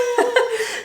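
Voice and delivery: short high-pitched laugh; a quick giggle. Real, not acted. Laugh alone on line.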